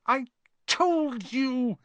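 high, breathless, drawn-out reproach; gasped. Acted. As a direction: gasp it out through a stitch. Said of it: "Hermione gasped, clutching at the stitch".

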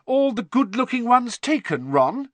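high, loud, superior teasing; loftily. Acted. Hermione teasing Ron about his standards, loftily. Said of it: "said Hermione loftily".

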